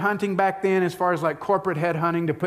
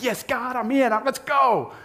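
eager yes; 7 semitones higher than usual. Retold or imitated. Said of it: "Moses just is like".

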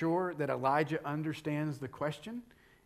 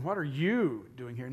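"you" raised and leaned on; stress on YOU; 3 semitones lower than usual. Shown as a demonstration. Preacher rejecting a reading of God's question, stress on YOU. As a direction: make "you" the pointed word. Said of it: "look at this and God going".

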